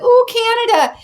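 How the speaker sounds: mocking swoon. Mocking people who praise Canada, in a high swooning coo. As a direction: swoon over Canada to mock the fans. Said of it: "Everyone's like".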